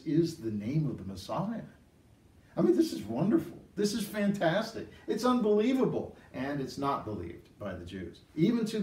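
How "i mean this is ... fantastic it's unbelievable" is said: excited. Real, not acted. Preacher marvels at the prophecy.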